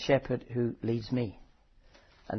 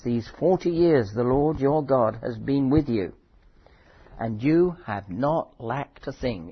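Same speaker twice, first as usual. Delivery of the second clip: louder, declaiming Bible reading; scripture reading; 4 dB louder than usual. Acted. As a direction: proclaim the verses to the congregation. Reads Deuteronomy aloud.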